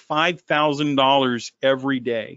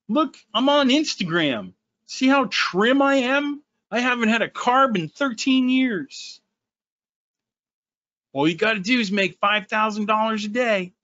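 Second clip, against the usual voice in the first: higher, smug show-off voice; mock Instagram guru; 7 semitones higher than usual. Lampooning a rival trading guru, smug and raised. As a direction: play the vain guru showing off.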